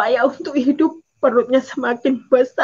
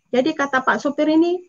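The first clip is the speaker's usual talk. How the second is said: usual speaking voice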